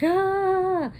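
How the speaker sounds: high, sustained mock scream of pain; high drawn-out "aah"; imitated pained scream. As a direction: cry out like the injured kid. Imitates injured boy's cry.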